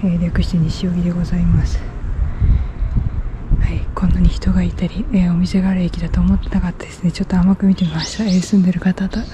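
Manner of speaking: shy whisper so passersby won't hear. Real, not acted. Vlogger embarrassed by passersby on the street, whispering. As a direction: whisper it, embarrassed by the people walking past. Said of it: "小声の小声だし".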